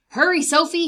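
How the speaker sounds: called loud and high; calling out. Acted. Mister McGinty calling Sophie to hurry, loud and high. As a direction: call the dog over with urgency. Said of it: "he called".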